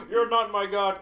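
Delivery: loud defiant declaration; defiant shout. Retold or imitated. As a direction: shout the refusal. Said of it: "replay of scene".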